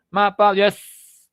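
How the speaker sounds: loud, slow "Ma, pa, yes", each syllable apart; clipped greeting. Shown as a demonstration. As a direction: drop "Ma, pa, yes" like a teen. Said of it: "Es más, rápido". (saludo juvenil recortado)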